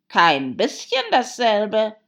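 loud, slow Hatter voice; Hatter, contradicting. Acted. Hatter correcting Alice, loud and emphatic.

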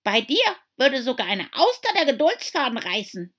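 high, loud snippy retort. A narrator's reading. Young crab to her mother, loud snippy. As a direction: snap at mother. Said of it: "ein wenig schnippig".

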